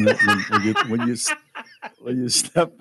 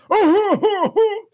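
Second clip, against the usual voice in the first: high laughing squeal; 5 dB louder than usual. Real, not acted. Reading dinner crimes, bursts out.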